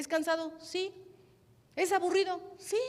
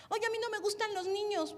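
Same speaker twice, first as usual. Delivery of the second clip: whiny complaint; 8 semitones higher than usual. Retold or imitated. Imita a una servidora que se queja.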